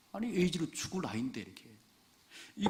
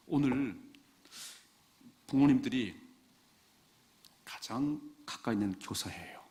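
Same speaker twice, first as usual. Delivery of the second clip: normal talking voice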